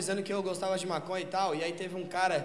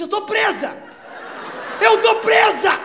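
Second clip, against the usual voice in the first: higher, louder cry of being trapped; mock panicked cry; 12 semitones higher, 12 dB louder, slower than usual. Girlfriend stuck between cars, crying out high and loud. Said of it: "Ela falou assim".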